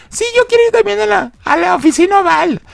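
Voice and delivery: put-on a child doing a silly Chucky voice; high mock-creepy doll voice; kid imitating Chucky. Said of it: "Como imitando la voz de Chucky".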